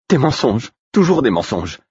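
high, wounded exclamation; hurt anger turned inward; bitter, to herself. A narrator's reading. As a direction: bitterly realise it aloud. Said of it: "dit-elle en s'adressant plus à elle-même qu'à moi".

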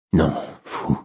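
refus doux, un peu bas; tristesse. A narrator's reading. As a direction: refuser avec une tristesse contenue. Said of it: "répondis-je tristement". (triste et las, sans éclat)

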